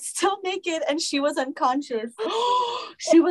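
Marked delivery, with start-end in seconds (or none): 2.2-2.9 sharp high gasp; shocked gasp. Real, not acted. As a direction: gasp in horror. Shock at the story.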